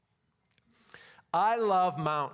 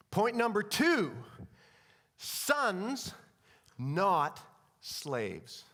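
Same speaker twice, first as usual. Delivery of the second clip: ordinary delivery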